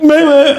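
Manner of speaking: high, loud comic bleat; imitated dazed yelp. Huge guy getting knocked out, imitated comic yelp. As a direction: mimic a big man's helpless noise. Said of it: "like that, this huge guy".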